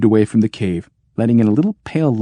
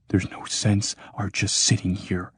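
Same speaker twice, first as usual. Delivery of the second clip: softer, hushed aside; whisper; 7 dB quieter than usual. Acted. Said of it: "At last Clopasius whispered".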